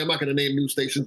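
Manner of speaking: ordinary delivery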